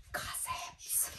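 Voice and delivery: whispered like a juicy secret; mock whisper. Confiding what coworkers talk about over coffee, mock whisper. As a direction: drop to a hushed, conspiratorial whisper.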